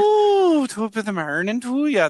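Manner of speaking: high, lilting put-on Irish accent. Shown as a demonstration. Finding the way back into Irish, lilting accent. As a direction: swoop up into a stage Irish accent. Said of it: "how do I do my Irish?".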